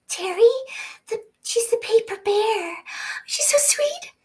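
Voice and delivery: very high, tiny cooing voice; cutesy gushing. Acted. Voice actor gushing over Terry the paper bear, cutesy. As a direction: gush in a high little squeak.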